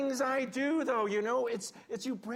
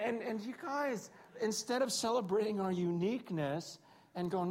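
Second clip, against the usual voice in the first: ordinary talk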